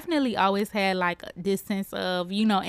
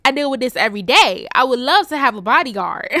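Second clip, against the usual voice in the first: playful, louder quoted comeback; lively jokey delivery with a laugh; joking; 4 semitones higher, 5 dB louder than usual. Retold or imitated. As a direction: toss it out as a joke, laughing midway. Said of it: "like, on some joking".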